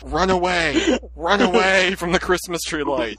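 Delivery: high, hammed-up cry to flee; mock panic. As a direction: play the fleeing crew in silly panic.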